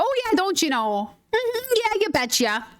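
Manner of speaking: sing-song Minnesotan you betcha, pitch jumping up; Minnesotan joke voice. Retold or imitated. Vlogger jokes to friends in a hammed-up Minnesotan accent. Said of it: "I make a quick joke about".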